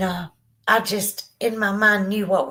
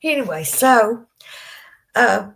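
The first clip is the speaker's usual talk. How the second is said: normal talking voice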